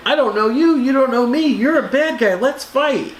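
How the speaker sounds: high, hammed-up comic book hero lines; cheesy comic hero. To Spider-Man, a hammed-up Silver Age comic hero voice. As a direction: play it like a corny old comic panel.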